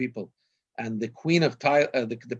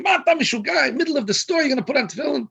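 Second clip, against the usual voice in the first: high, loud mock outrage; screaming; 13 semitones higher, 5 dB louder, much faster than usual. Israeli retold screaming at friend about tefillin in the store. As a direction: yell in disbelief, imitating an appalled Israeli. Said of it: "the other guy starts screaming".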